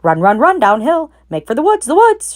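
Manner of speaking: high urgent shout, climbing. A narrator's reading. Goose shouting at the escaping pig. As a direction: yell him toward the woods. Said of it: "she shouted to Wilbur".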